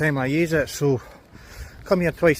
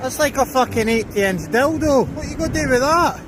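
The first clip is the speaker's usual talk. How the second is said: pitched right up and loud, ribbing the dog; high, loud teasing at the dog; 8 semitones higher, 3 dB louder than usual. Real, not acted. Owner teasing his dog over a huge stick, high and loud. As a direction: egg the dog on, high and loud, half laughing at it.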